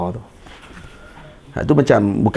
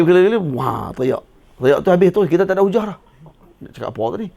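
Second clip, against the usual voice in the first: ordinary talk, nothing marked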